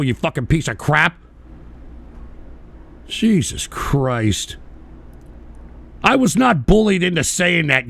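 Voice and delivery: ordinary delivery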